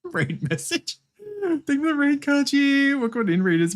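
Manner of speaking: high, bright streamer greeting; cute anime streamer. Acted. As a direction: greet the raiders sweetly.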